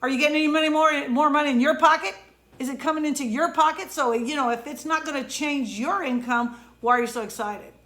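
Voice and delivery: quoting someone who rains on my parade, fast and scoffing; incredulous scoffing. Retold or imitated. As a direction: scoff at my excitement. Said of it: "And they said".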